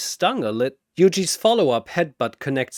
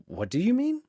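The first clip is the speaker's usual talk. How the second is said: much higher, curious question; genuinely confused; puzzled; 11 semitones higher, faster than usual. Acted. Shazam tilting his head at Chen, puzzled.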